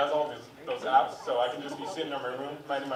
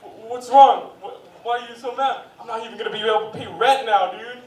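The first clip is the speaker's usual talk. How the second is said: loud, higher mock despair; devastated gambler; 7 semitones higher, 9 dB louder than usual. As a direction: whine about losing the bet. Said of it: "people who watch fighting games competitively".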